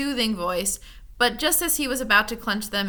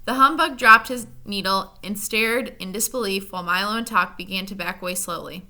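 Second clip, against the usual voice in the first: usual speaking voice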